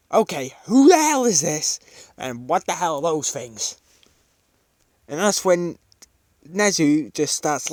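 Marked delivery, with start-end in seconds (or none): 0.1-3.8 alarmed shout. Acted. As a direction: yell it like the demons just walked in. Mike shocked by demon army.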